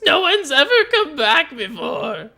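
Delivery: high, slow, loud wail through tears; sobbing Wizzo. Acted. Wizzo hugging the adventurer, high teary wail. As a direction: blubber it out with joy and grief. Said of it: "he's sobbing".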